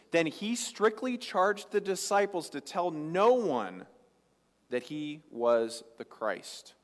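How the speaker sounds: slow, with "no" leaned on heavily; slow reading that hits the word "no"; emphasis on no. Shown as a demonstration. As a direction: stretch out the charge and press "no". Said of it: "I wanna emphasize one word here".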